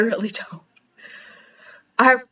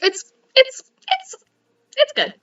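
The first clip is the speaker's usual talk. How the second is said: strained high reassurance; 13 semitones higher than usual. Real, not acted. Wavering over half-done quilt.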